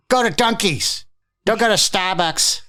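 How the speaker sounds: high, insistent mocking fan voice. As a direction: squeal orders like a zealous coffee fan. Mocking Dunkies fans.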